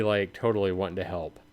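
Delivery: ordinary talk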